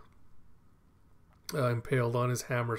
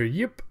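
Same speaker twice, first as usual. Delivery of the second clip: short yelp shooting upward in pitch; imitated dog yip; 4 semitones higher than usual. Stray dog hit by a spear, imitated yip. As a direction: mimic a speared dog's yelp.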